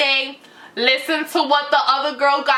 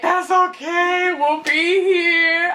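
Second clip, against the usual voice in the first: higher, slow, lightly tearful reassurance; mock devoted; 5 semitones higher, slower than usual. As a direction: play the loyal girlfriend a bit too earnestly. Retold devoted girlfriend reply.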